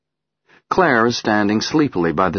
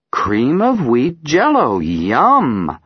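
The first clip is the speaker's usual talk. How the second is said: slow, louder playful teasing; 7 semitones higher, 5 dB louder, much slower than usual. Acted. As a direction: tease the child cheerfully. Mother teasing.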